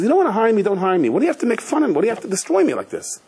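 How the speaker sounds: hurt man shouting back at his interviewer; retold yelling. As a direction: applicant yelling at the interviewer who mocked him, high-pitched. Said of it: "he starts yelling at this guy".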